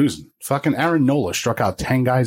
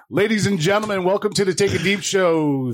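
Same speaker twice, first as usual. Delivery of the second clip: announcer voice; 6 semitones higher than usual. Acted. Opening the live show.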